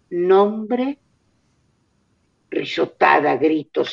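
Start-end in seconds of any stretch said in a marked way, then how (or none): 0.1-1.0 lightly voiced doll, eerily calm; a hint of a too-calm creepy doll. A narrator's reading. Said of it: "Con voz de muñeca". (voz de muñeca serena e inquietante)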